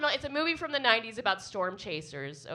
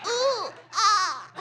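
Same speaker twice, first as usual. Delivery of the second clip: high loud hammed-up moan through laughter; high, loud mock moan; mock sex moan; 12 semitones higher, 8 dB louder than usual. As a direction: overdo a porn moan, laughing. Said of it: "is that a sex?".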